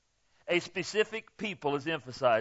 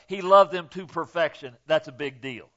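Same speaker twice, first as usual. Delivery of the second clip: ordinary delivery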